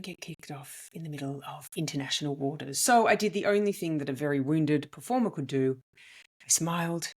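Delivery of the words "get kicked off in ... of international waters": hushed aside, softer; retold whisper. Comedian voicing her professional side holding her back, hushed. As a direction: drop to a near-whisper of caution.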